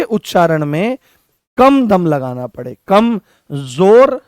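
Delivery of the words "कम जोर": कम जोर drawn out very slowly, a bit louder; slow stress. Shown as a demonstration. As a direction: stretch कम जोर slowly to show little force. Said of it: "कम जोर लगाना पड़े". (कम जोर बहुत धीरे, खींचकर और ज़ोर देकर)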